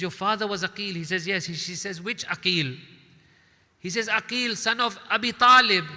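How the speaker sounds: woman's questions mounting to a high, loud peak; rising intensity. Acted. Dialogue rising to climax.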